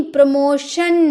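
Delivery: प्रमोशन ending in "shun", not "tun"; प्रमोशन with "sh" where the t is written; correct SH pronunciation. Shown as a demonstration. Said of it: "लेकिन टन नहीं".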